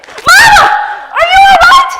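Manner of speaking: high, very loud cry of alarm; panicked shout. Acted. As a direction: shout in panic for a choking mother. Finds mother choking.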